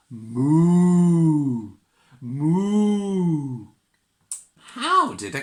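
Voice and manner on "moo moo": loud long moos; cow moo. Shown as a demonstration. Getting the child to moo along, loud moos. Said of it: "Can you go moo with me?".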